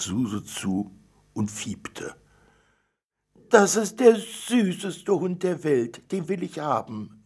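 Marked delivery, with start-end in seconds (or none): 3.5-7.2 much higher, louder cry of delight; jubilant. Acted. Girl delighted by the puppy she wants to take home, jubilant. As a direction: gush over the puppy. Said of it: "jubelte sie".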